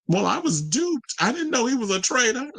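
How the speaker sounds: playing dumb. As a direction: feign innocence. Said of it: "I would have played it off like".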